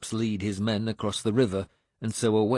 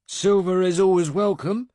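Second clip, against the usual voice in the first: guarded; 10 semitones higher, 6 dB louder than usual. A narrator's reading. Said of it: "he said guardedly".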